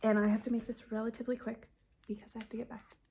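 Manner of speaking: fast hurried whisper; rushed whisper. Real, not acted. Explaining to viewers she's on a break, fast whisper. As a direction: race through it under your breath.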